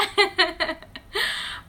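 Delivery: short high giggle; high laugh. Real, not acted. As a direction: giggle at her own lisp. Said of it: "sound like this".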